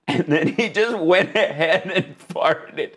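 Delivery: words breaking up with laughter; laughing hard. Real, not acted. Streamer retelling the fart, helpless with laughter. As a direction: giggle through to the last word. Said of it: "laughing so hard I'm crying".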